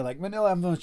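normal talking voice

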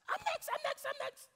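high, strained cry of panic; a scared disciple sure he's next; panicked fear. Acted. Frightened disciple hiding behind shut doors, panicking.

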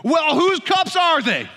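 high, loud, slow indignant demand; hammed-up outraged dad; mock outrage, drawn out; righteous indignation. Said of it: "Righteous indignation".

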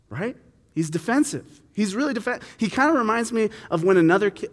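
usual speaking voice

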